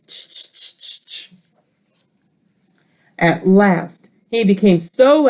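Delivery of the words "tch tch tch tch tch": whispered, soft tch tch; monkeys tutting. Acted. Monkeys tutting back at the furious peddler. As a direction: tut like teasing monkeys. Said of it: "and said".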